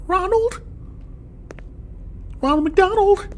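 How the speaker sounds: high, frightened calling out; scared. Real, not acted. Sees the monster.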